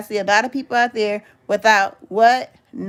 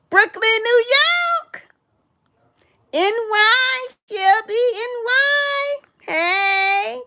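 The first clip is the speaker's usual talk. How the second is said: much higher, hyped welcome call; excited shout-out; 12 semitones higher, slower than usual. Real, not acted. Hyping up New York viewers on her stream, high excited call. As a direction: sing out the city like a party host.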